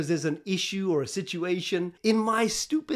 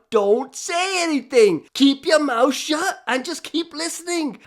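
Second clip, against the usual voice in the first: louder, higher and slow, pressing the point; insistent inner voice; 9 semitones higher, 6 dB louder, slower than usual. Retold or imitated. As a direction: slowly hammer the warning home. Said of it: "I literally can hear this guy talking to me".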